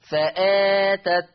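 فآتت with the long vowel after the hamza stretched slowly; تلاوة فآتت بمد البدل; elongated badal madd. Shown as a demonstration. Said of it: "هكذا".

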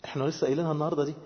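ordinary talk, nothing marked

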